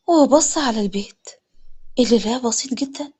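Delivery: plain reading voice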